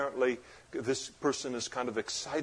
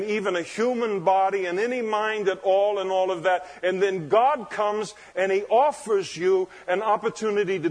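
raised, emphatic preacher voice; forceful preaching; 7 semitones higher, 6 dB louder than usual. Acted. Preacher to congregation on God's grace, forceful preaching. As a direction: drive each point home from the pulpit.

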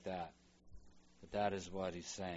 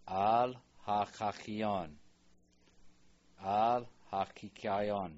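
slow model reading; 4 dB louder, much slower than usual. Shown as a demonstration. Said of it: "slow loud model reading".

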